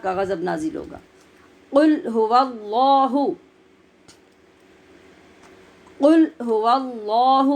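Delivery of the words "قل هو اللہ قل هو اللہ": قل هو اللہ recited slowly, drawn out; tajweed demo; slow Quranic recitation. Shown as a demonstration. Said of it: "وسط حلق سے".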